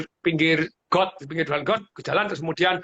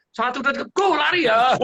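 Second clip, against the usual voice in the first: race countdown; 6 semitones higher than usual. Retold or imitated. Father counting off his children's running race, calling out. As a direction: call the start of a race.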